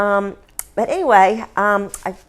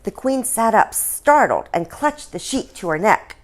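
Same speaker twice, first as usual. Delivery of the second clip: usual speaking voice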